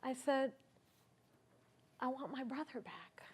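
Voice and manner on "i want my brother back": much softer, holding back tears; telling his brother he wants him back, choking up; choked-up plea. Retold or imitated. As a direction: barely get it out, throat tight. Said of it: "And I choked up".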